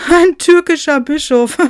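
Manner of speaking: high, loud, laughing exclamation; laughing jeer. Acted. Someone mocks Bishop Nikolaus, laughing. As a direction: shout it in mocking hilarity. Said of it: "lachte jemand".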